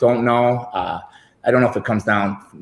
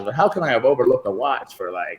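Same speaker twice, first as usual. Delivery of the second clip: ordinary talk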